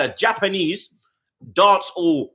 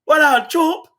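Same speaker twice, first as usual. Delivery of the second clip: imitating a friend; 10 semitones higher, 4 dB louder, slower than usual. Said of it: "him like".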